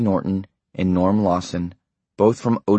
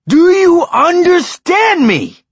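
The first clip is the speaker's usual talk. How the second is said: much higher, louder, slow furious shouting; furious tirade; 10 dB louder, slower than usual. A narrator's reading. Husband laying down the law to his wife, furious shouting. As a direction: hammer each line at her in rage.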